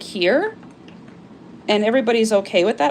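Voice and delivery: ordinary talk, nothing marked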